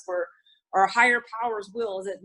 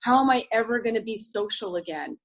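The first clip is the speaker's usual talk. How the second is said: ordinary talk, nothing marked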